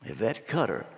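low, soft repeat of the name; puzzled asking around. Acted. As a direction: pass the name along quietly, unsure who it is. Crowd asking who that is.

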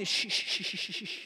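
string of soft, voiceless shushes; acted crowd shushing; repeated shushing. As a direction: hush someone with quick little shushes. Crowd hushing the man.

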